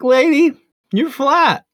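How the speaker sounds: laughing tease. Retold or imitated. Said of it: "and I was like".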